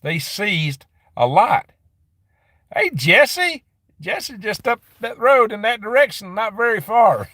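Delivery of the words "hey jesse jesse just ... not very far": high, excited call-out to a friend; excited greeting. Real, not acted. Greeting viewer who lives nearby.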